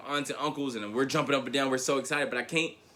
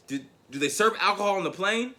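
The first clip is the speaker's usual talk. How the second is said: grandfather asking hopefully; 7 semitones higher than usual. Retold or imitated. Nervous grandfather asks.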